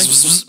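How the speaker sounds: buzzing power-tool zzz; impact wrench noise. Shown as a demonstration. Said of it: "Demande-moi de faire n'importe quel bruit".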